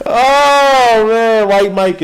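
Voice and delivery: high, loud, slow cry of disbelief; real amused exclamation; excited outburst. Real, not acted. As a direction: burst out at the chat. Laughing outburst at chat.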